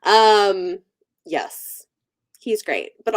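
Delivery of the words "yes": normal talking voice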